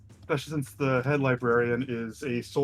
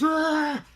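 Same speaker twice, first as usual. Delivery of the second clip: high yell as he charges; charging yell; 4 dB louder than usual. Acted. Bulbug charging through the doors, high yell. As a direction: let out a short high battle cry. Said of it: "He just runs".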